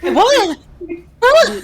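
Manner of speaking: high, loud laughing What; laughing shock. Real, not acted. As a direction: burst out laughing in disbelief. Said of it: "We'll circle back to the mommy thing".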